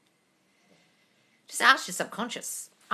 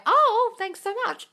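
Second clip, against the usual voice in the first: much higher, slow, sweet put-on voice; girly mock reply; 5 dB louder, slower than usual. Imagined friend being asked out, answering in a high sweet voice. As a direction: play the flattered girl.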